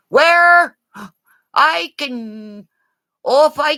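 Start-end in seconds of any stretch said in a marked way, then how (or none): none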